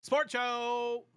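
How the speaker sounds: high, loud show-intro call; show intro shout. Acted. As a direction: announce it like a hype host. Opening the sports show.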